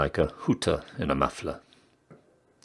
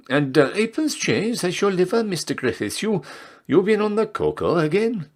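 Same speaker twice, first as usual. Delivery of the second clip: chatty barmaid; 12 semitones higher than usual. Acted. Barmaid voice again.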